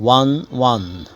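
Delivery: won said exactly like one. Shown as a demonstration. المدرس ينطق won زي one. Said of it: "كأني بقول number one".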